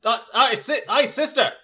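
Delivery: high, bright greeting; cheerful brother voice. Acted. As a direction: greet your sister happily. Brother answers on the radio.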